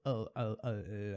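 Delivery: a quiet held L with the tongue up; soft L sound. Shown as a demonstration. Teacher softly demonstrating the L sound. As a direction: hum a soft L, tongue on the roof. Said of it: "esse som também de L".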